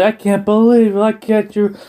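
high, slow mock outrage; hammed-up disbelief; outraged wife. Wife catching husband in a compromising hold, mock outrage. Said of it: "she's like".

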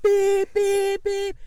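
high-pitched honking beeps; loud vocal horn blasts; imitated car horn.

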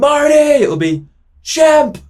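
high, drawn-out holler of a name; exaggerated high-pitched name call; mock call. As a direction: call it out long and high, hammed up. Mock-calling the chimp's name in film.

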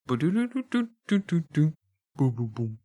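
sung video game death jingle; Mario dying sound. Shown as a demonstration. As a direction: hum the Mario death tune softly. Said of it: "That was the Mario dying sound".

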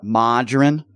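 MARGARINE with the r dropped, "mah-jerin", New York style; Bronx New York accent. Retold or imitated. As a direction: imitate a New Yorker saying margarine as "mahjarin". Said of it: "as she would call it".

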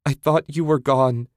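high, loud distraught cry; anguished cry. Acted. Woman to the man she believed gone, crying out. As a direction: cry it out with full emotion. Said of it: "Susan cried".

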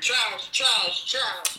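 loud repeated jeer; mocking heckle. Real, not acted. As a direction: call it trash, loud and dismissive. Rival fan mocking the Jets.

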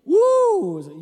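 high, loud celebratory whoop; excited whoop. Real, not acted. Pastor cheering the congregation's reply, loud excited whoop. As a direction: let out a joyful woo.

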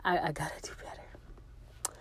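soft, whispered self-reproach. Real, not acted. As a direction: say it to yourself under your breath. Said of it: "self-reproach repeated".

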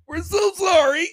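very high, squeaky mock apology. Host mocking the brand's apology in a squeaky voice.

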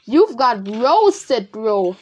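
drawn out, loud roast; slow loud taunt. Pretend. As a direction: stretch every word as a taunt. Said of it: "ich mach diesen Roasted Emote".

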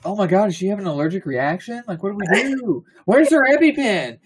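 high, rising alarmed chatter; panicky bystanders. Retold or imitated. Bystanders panicking over the crying girl, high mock alarm. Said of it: "they're like".

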